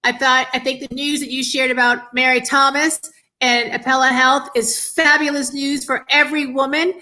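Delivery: ordinary talk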